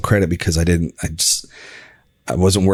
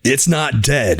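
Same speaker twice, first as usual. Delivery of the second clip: higher, punchy wrestler shout; wrestling promo; 6 semitones higher, much slower than usual. Pretend. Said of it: "your wrestling voice or wrestler voice".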